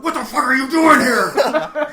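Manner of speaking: loud, high, slow angry question; furious demand. Retold or imitated. To the guy in the kitchen, furious but laughing. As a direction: grab him and demand it. Grabbing his shirt in kitchen.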